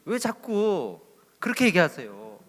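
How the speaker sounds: slow, exasperated protest; annoyed church member. Retold or imitated. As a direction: drag it out, fed up with the questions. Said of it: "교인이 화가 났습니다". (화가 난 교인이 느리게 따지듯)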